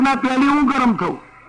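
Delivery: stubborn pot voice. Acted. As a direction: play the pot insisting on heating first. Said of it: "પણ તપેલી કંઈક".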